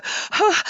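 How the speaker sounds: sharp, high, unvoiced gasp for air; hyperventilating gasp. Calling David after hearing the CD, a panicked gasp. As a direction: gasp in retold panic, fighting for breath. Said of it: "and then you're hyperventilating".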